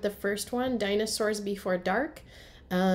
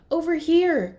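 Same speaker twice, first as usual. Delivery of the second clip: higher, louder call; 8 semitones higher, 9 dB louder than usual. Acted. Annie calling Jack to the oak tree, loud call. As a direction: call him over from under the tree. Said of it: "called Annie".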